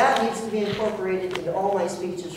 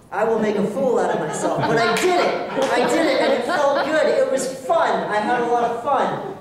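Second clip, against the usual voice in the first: laughing through the words, a bit louder; laughing happily; 3 semitones higher, 5 dB louder than usual. Real, not acted. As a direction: let real laughter spill into it. Laughs recalling her brave speech.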